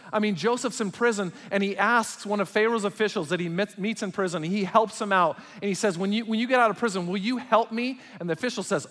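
loud, high pulpit preaching; impassioned sermon delivery; fiery preaching. Acted. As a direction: preach it out with full force. Preaching at full force.

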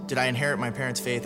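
ordinary talk, nothing marked

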